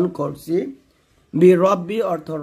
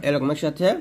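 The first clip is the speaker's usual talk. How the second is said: normal talking voice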